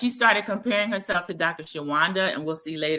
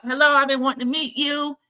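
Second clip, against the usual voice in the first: a light, higher Southern lilt, imitating; cute Southern accent; 7 semitones higher, 4 dB louder than usual. Host mimicking the doctor meeting Titus, lightly Southern. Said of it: "She has a cute Southern accent".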